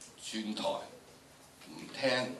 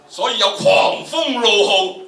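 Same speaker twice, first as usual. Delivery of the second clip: very loud, high and slow; booming storm recital at near full volume; roaring storm; 11 semitones higher, 13 dB louder than usual. Shown as a demonstration. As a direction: let the gale howl through the words. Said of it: "呢度起碼要八度、九度啦".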